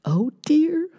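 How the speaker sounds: lightly voiced worried mother; fretting mother mouse. Mother mouse frets over crying baby, mild worry. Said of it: "said Mother Mouse".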